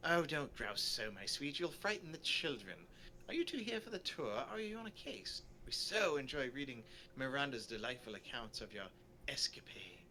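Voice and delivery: chatty, lilting storybook tourist; polite small talk with swooping pitch; genteel tourist character. Acted. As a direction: play a pleasantly sociable sightseer. Voices the sociable tourist.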